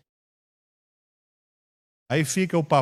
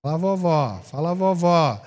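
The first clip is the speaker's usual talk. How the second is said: doting grandmother; 4 dB quieter than usual. Acted. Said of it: "vó Coruja".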